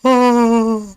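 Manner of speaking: long, heavy, audible sigh; loud weary sigh. Real, not acted. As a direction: let out a big tired sigh. Loud sigh while tired.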